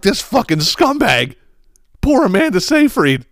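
high, incredulous indignation; exasperated outrage. Retold or imitated. Host reacting to the husband in the film, high-pitched outrage. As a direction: push the voice way up in disbelief.

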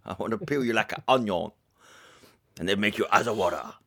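lightly put-on French accent, low and creepy; a hint of a sleazy French seducer; mock French accent.

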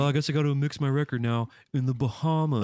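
sarcastic woe-is-me groan; mock reluctance. Mock complaint about a vacation job.

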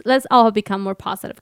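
usual speaking voice

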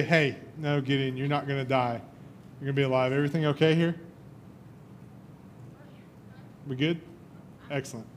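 soothing hey no, you're not gonna die; calming angel. Acted. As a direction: gently talk him down. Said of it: "the angel has to calm him down".

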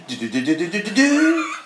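imitated electronic toy jingle, jumping high at the end; "do-do-do" riff climbing high; toy guitar jingle. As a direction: mimic a sensor toy going off. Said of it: "it goes".

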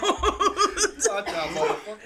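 laughing, high and loud; laughing echo. Retold or imitated. Friend laughing as he repeats the warning.

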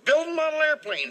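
high, loud little fairy voice. Retold or imitated. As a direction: squeak it like a tiny fairy. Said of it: "says the little fairy".

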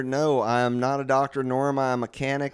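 low, weary lightly voiced coworker; a hint of a fed-up clerk; bored coworker voice. Mark character continues.

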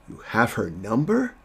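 incredulous question, pitch climbing high at the end; imitated surprise, rising sharply; mock incredulity. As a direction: retell it as a disbelieving question. Acting Butcher's surprised question to Huey.

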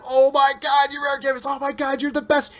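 high, breathless fan gushing over his hero; starstruck gushing. Retold or imitated. Fan meeting his childhood baseball hero, gushing high and excited. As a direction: gush like a kid meeting his idol.

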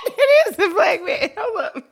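laughing through the words; cracking up mid-sentence. Real, not acted. Laughing at own mistake.

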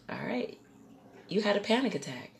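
soft, understated diagnosis; calm doctor. Retold or imitated. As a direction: deliver it quietly, matter-of-fact. Voices doctor giving diagnosis.

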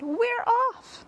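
very high, loud cheer; excited cry. A narrator's reading. The others answering the centipede, high excited cry. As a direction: echo the shout with glee. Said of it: "the others cried".

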